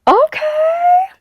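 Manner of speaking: high, loud happy cry; excited shout. Real, not acted. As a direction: burst out with real delight. Trying on new glasses.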